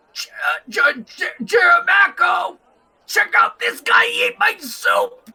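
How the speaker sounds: high, stammering yell that builds; overjoyed innkeeper, loud and high; excited shout. Acted. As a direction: holler the big news across the bar. Innkeeper shouts news to elf.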